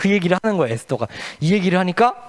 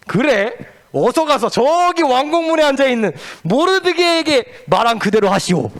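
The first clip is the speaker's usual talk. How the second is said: king commanding; 7 semitones higher than usual. Acted. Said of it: "왕께서 이렇게 말하는 거예요".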